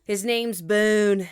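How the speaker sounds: slow, with Boone stretched long, Southern style; Southern drawn-out vowel. Real, not acted. Repeating the puppy's name to a friend, Southern drawl. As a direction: draw out Boone in a Southern twang. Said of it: "elongating the vowel or saying it in the Southern accent".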